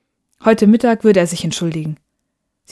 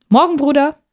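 much higher, louder call across the kitchen; 8 semitones higher, 3 dB louder than usual. Acted. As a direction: sing out a morning greeting. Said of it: "rief eine Stimme".

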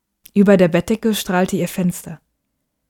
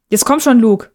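higher, louder impatient call; father calling his son to the car; 4 semitones higher, 5 dB louder than usual. Acted. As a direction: hurry the boy along from the car. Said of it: "rief er".

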